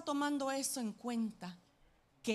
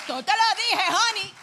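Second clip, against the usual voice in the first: higher, much louder gloating I-told-you-so; 8 semitones higher, 9 dB louder than usual. Retold or imitated. Preacher gloating to the pastor, loud teasing. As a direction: crow it at him, triumphant.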